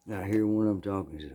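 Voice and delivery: usual speaking voice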